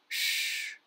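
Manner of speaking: long unvoiced shh; hushing shh. A narrator's reading. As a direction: shush them with a drawn-out hiss. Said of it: "He put a finger to his lips and said".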